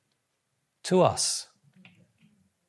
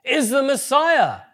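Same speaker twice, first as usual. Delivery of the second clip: imagined joyful declaration; 10 semitones higher, 6 dB louder, slower than usual. Retold or imitated. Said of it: "they go".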